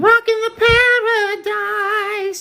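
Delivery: very high sung-out word; high flourish. Pretend. Playful flourish to chat. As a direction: pop it up high. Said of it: "high flourish".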